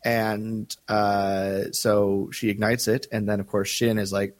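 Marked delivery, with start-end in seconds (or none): none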